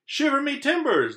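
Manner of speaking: very high, loud pirate cry; pirate exclamation. Acted. Pirate surprised at the beach, very high and loud. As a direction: squawk it like a startled old pirate. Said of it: "the pirate said".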